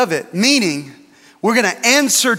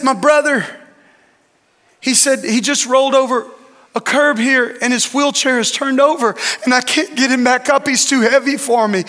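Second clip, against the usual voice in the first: put-on pleading child; 6 semitones higher than usual. Boy pleads for help.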